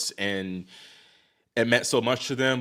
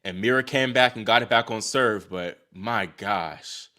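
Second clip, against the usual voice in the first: ordinary talk, nothing marked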